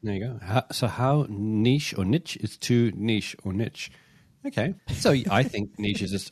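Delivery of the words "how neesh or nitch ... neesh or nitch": each niche or niche as "neesh or nitch"; both pronunciations. Shown as a demonstration. Re-reading the question for both camps, niche as "neesh or nitch". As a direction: slowly give niche both ways, long ee then short i. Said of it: "We say niche, they say niche".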